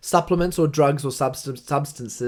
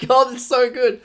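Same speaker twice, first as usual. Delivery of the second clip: much higher and louder, gushing through a laugh; delighted mate; 10 semitones higher, 7 dB louder than usual. Retold or imitated. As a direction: mimic a friend blown away by the coffee. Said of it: "He was like".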